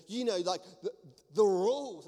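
imitating someone annoyed at having to explain; a bit annoyed. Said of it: "she was getting a bit annoyed".

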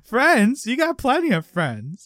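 high, hammed-up dad voice; sitcom dad. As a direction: imitate a cartoonish dad scoffing. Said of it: "then the dad's like".

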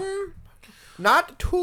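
very high Python counting voice; high-pitched Holy Grail voice. Acted. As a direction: count solemnly in a silly high voice. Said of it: "Their high-pitched voice".

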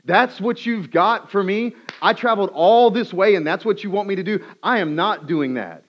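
high, loud, indignant outburst; furious. Acted. Naaman rejecting the prophet's instruction, furious and shouting. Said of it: "he's furious".

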